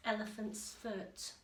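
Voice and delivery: usual speaking voice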